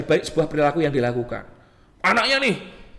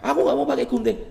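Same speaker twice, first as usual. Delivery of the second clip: higher-pitched mock child voice; small childish protest, acted; child refusing; 6 semitones higher than usual. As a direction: play a reluctant kid answering. Acts the child's answer.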